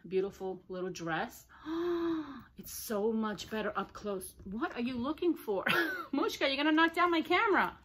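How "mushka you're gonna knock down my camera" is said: higher, louder warning to a pet; half-amused, raised-voice warning; calling out to dog. Real, not acted. Dog nearly topples the camera.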